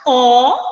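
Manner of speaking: "Ó" alone, loud and held; sounded-out syllable. Shown as a demonstration. Teacher showing how the verse sounds, "Ó" sounded out loud. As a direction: sound out "Ó" as one full syllable. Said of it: "Olha a sonoridade".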